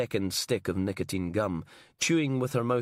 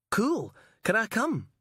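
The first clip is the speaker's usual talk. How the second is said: put-on higher, bright young cameraman, keen; eager Alec; 12 semitones higher than usual. Cameraman Alec asking to tag along, eager and higher. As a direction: perk up like a keen kid.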